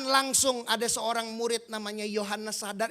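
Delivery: normal talking voice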